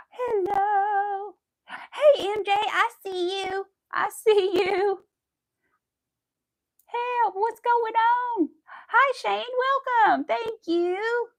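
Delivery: high, loud, drawn-out welcomes; excited greetings. Real, not acted. Greeting viewers joining the livestream, loud and high. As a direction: call out happily to each new viewer.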